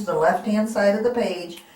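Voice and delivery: ordinary talk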